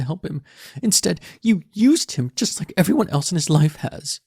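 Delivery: put-on high, loud teen girl, crying with rage; tearful furious daughter. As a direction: tear into him through tears.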